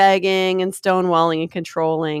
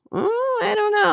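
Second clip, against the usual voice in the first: unsure. Real, not acted. Sudden unsure exclamation.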